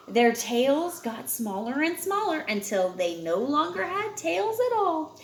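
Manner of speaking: ordinary delivery, nothing marked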